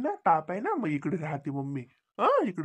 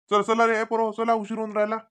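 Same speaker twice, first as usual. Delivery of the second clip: put-on loud, raised call to the kids; 5 semitones higher, 8 dB louder than usual. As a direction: hurry the children along, loudly. Hurrying the children to leave.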